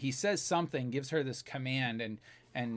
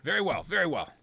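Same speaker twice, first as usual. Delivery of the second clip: higher-pitched, barked soldier's order; stormtrooper; 4 semitones higher, 4 dB louder than usual. Acted. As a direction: give in, then order the prisoner up sharply. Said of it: "And they say".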